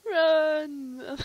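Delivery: loud sudden yell; shout. Real, not acted. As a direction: yell it out in alarm. Yelling at game character.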